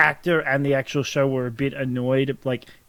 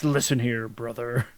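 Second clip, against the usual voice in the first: low gravelly wrestler growl; lightly voiced Hulk Hogan promo; 3 semitones lower, 6 dB quieter, slower than usual. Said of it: "there's only one wrestler voice, and that's the Hulk Hogan voice".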